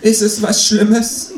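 high, resigned patient voice; fatalistic ER extra. Acted. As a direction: sound like a doomed TV patient. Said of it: "im fatalistischen Tonfall eines Emergency Room Statisten".

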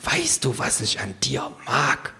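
leise, erhöhte Stimme, vertraulich; Känguru. Acted. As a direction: leise und vertraulich fragen. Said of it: "fragt das Känguru". (leise Känguru-Stimme)

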